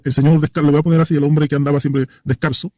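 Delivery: ordinary talk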